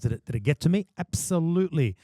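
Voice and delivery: usual speaking voice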